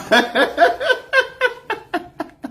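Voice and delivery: long, high-pitched helpless laugh; high cackling laughter, trailing off; hard laughing fit. Real, not acted. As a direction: laugh till the tears come. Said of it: "when I laugh hard like this".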